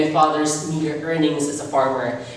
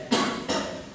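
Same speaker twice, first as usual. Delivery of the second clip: weak cough; 6 dB quieter than usual. Acted. Dying mother coughs.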